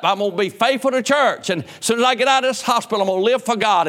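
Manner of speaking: high, loud mock of a sickbed promise; mock backslider vow. Pastor mocking members who promise God from hospital beds, mock earnest vow. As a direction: ham up a churchgoer swearing to be faithful.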